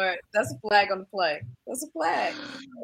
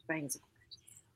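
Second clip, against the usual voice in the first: hushed breathy whisper; 3 semitones lower, 11 dB quieter than usual. Real, not acted. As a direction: whisper it under your breath. Whispered compliment aside.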